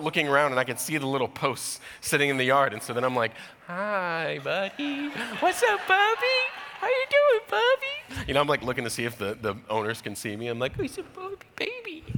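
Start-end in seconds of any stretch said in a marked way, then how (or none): 3.7-8.3 put-on very high, sing-song baby voice; high cooing voice for a scary dog; baby talk to a dog. Sweet-talking the angry dog. 10.8-12.0 put-on very high, sing-song baby voice; high cooing voice for a scary dog; baby talk to a dog. Sweet-talking the dog.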